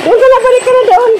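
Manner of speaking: high, carrying call across the field. Real, not acted. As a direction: shout it out to someone far off. Calling out to someone far.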